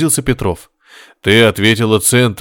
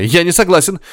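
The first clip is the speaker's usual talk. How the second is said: shouting; 6 semitones higher than usual. A narrator's reading. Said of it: "закричал Горишвили".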